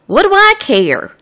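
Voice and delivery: mild grumble. Pretend. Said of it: "I can just hear you grumbling".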